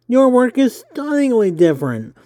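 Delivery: high nerdy interviewer voice. Acted. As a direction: pitch it up, eager and nerdy. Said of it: "I hope my nerdy voice isn't too nerdy".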